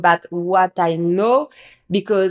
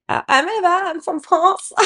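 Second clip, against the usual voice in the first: higher, timid little voice, a bit unsure; awkward self-introduction; 9 semitones higher than usual. Retold or imitated. Nervous newcomer introducing herself to locals at a networking event, timid higher voice. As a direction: shrink a little as you introduce yourself. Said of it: "I was so uncomfortable".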